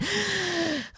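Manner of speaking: high, breathless gasp; sharp gasp. Retold or imitated. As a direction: gasp for air mid-sentence. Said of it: "That's literally what she sounded like".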